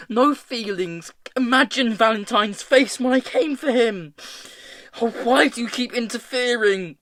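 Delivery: high, loud, anguished tirade; distraught screaming. A narrator's reading. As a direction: rail at him in furious despair. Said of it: "screamed Hester".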